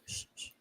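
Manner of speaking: soft playful shh; shushing. Pretend. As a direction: hush them with a breathy shh. Promising to keep secret.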